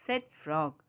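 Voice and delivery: usual speaking voice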